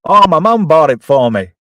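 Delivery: a cheeky kid's put-on voice. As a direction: play the proud kid showing off his gun. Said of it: "Oh, sorry, I did a voice".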